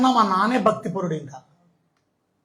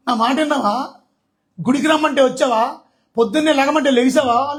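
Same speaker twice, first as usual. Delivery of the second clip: God's rebuke; 6 semitones higher, 5 dB louder than usual. Pretend. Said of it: "రేపు దేవుడు అంటాడు".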